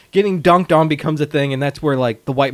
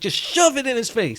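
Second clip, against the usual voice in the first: raised-pitch imitated complaint; mock arguing; 7 semitones higher than usual. Sidney telling Billy how to finish, mock exasperation. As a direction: keep up the mock indignation, pitched high. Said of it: "they start arguing about that".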